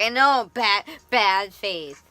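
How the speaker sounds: loud, resigned repeating; exasperated admission. Retold or imitated. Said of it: "I'm like".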